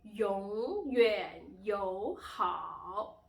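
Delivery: 永远友好 very slow and loud, swooping tones; teacher's exaggerated tone drill on 永远友好; third-tone demo. Shown as a demonstration. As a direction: stretch each syllable of 永远友好 through its dip and rise. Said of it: "these are the four tones".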